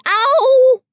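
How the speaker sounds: Piglet's pained ow as he comes down; high yelp of pain. A narrator's reading. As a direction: yelp on landing. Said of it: "he said".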